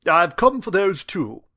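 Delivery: high, loud, slow British teacher; British accent. A narrator's reading. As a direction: announce it in suave, measured British English. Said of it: "his flawless British accent".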